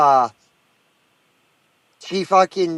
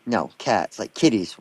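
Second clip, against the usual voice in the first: usual speaking voice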